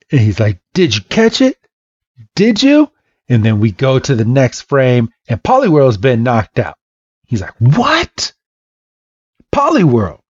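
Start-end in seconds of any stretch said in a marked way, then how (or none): 0.7-2.9 high, hopeful questions leaping up at the end; acted breathless-with-hope excitement; eager asking. Trainer eagerly asks after throw. 7.6-10.2 high startled outcry; dismayed cry for your fallen partner; acted shock. Trainer finds his Pokemon knocked out.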